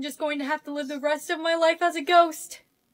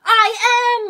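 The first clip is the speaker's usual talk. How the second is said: high, loud protest; exasperated shout; 10 semitones higher, 8 dB louder than usual. Acted. Unseen girl insisting she's still here, exasperated. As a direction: shout it to be noticed.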